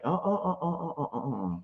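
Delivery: soft, quick mock tut-tutting; disapproving mom. Mom stopping her crying daughter, softly disapproving. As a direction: cut in gently as a disapproving mom. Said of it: "And Mom's like".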